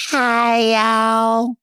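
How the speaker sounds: high, loud sing-song Hi y'all. Pretend. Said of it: "[name] Watch theme intro".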